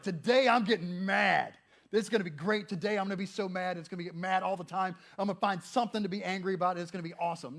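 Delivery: loud, gleeful resolve to get mad; mock-enthusiastic morning pep talk; mock eagerness. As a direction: play it hammed-up, like announcing great plans.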